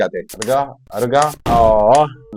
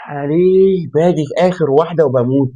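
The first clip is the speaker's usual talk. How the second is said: slow, higher, louder lament; dramatic complaint; 6 semitones higher, 5 dB louder, slower than usual. Real, not acted. Gamer complaining he dies at the last one, slow dramatic. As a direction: wail it theatrically.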